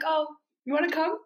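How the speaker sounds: quick, high mock invitation; lightly imitated offhand asking, high-pitched; Carol. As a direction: toss out the question high and fast. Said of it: "the way she always proposes things to Therese".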